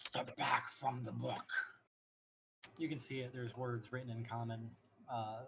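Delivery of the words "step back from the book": slow whispered wolf threat; menacing whisper. Acted. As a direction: hiss a warning as the wolf. Wolf guard warning Jacka.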